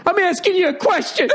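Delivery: very high, whiny mock wail; mock crying. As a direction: imitate a grown man breaking into tears. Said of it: "He starts crying".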